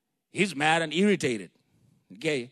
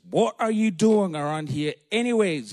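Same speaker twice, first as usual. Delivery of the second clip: louder, mildly angry demand; 7 dB louder than usual. Acted. Oldest brother confronting David, angry demand. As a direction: read it as an accusing question. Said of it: "he was angry, sorry".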